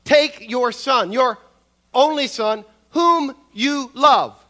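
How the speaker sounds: slow, halting, every phrase stressed on its own; deliberate, emphatic, stretching "whom you love"; staccato emphasis. Shown as a demonstration. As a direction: punch out "Take your son", "your only son", "whom you love" slowly with short breaks. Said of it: "staccato, a kind of halting pronunciation".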